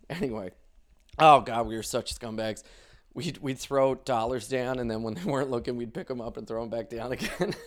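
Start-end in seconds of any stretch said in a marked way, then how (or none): none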